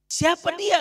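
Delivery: high, loud anguished question; anguished asking. Acted. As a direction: cry out the question in grief. Weeping beggar demands who he was. (pengemis bertanya sambil menangis keras)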